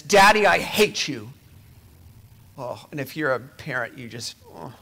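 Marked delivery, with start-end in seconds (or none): 0.0-1.3 put-on snapping child. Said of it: "snaps at his dad and says".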